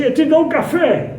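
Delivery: a mild mock yell to stop her; shouted. He calls after the leaving woman, a mild retold shout. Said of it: "Aí eu gritei assim".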